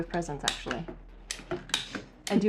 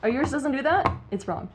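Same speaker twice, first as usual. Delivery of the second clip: ordinary talk, nothing marked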